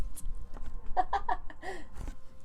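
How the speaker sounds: high trailing giggles; real laughing fit. Real, not acted. Reader unable to finish the line, giggling. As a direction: giggle helplessly.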